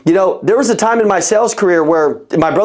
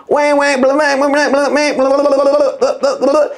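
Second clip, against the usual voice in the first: mocking blah; 6 semitones higher than usual. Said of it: "a talker".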